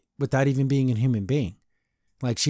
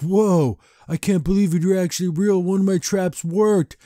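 a hint of an awed weirdo, higher and slowed; lightly voiced creepy collector, slow and higher; creepy hunter; 6 semitones higher than usual. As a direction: marvel at the captured demon like a creep. Excited hunter finds a real demon.

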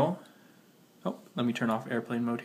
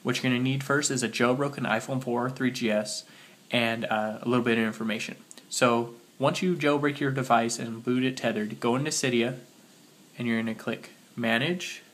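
normal talking voice

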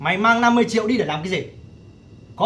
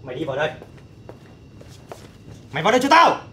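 angry order; faster than usual. Acted. Older brother dragging in younger brother.

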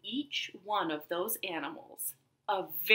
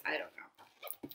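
whispered aside; 4 semitones lower, 12 dB quieter than usual. Real, not acted. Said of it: "I think skunks are".

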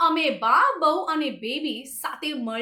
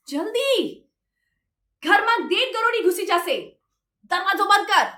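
high-pitched alarmed warning; acted-out raised-voice command; retold urgent yell; 5 semitones higher, 5 dB louder than usual. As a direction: imitate the mother-in-law urgently calling out. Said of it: "ચકીએ બૂમ મારી".

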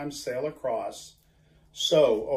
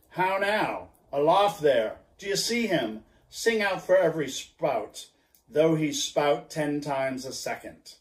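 calling aloft; 2 semitones higher than usual. Acted. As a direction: shout up to the lookout. Ahab hails the masthead.

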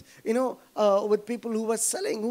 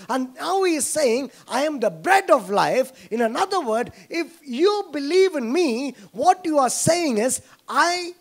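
loud, rising sermon delivery with high emphatic peaks; fervent preaching; 4 semitones higher, 5 dB louder than usual. Acted. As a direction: preach it full force, pressing each line home. Preaching at full force.

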